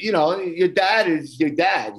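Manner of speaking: ordinary talk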